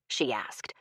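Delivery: usual speaking voice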